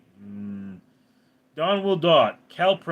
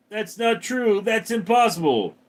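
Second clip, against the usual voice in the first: Luke denial; 9 semitones higher, 4 dB louder than usual. Retold or imitated. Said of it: "It's like".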